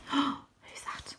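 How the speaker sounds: soft, startled whisper aside; hushed whisper. Real, not acted. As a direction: whisper it to the listener.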